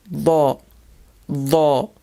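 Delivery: Lo begun with a heavy voiced th as in mother; emphatic Th. Shown as a demonstration. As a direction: say Lo with the tongue tip slightly out, th not L. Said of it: "a very emphatic Th sound".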